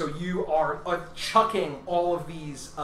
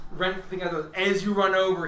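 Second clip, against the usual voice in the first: ordinary talk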